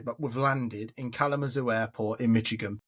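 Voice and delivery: ordinary talk, nothing marked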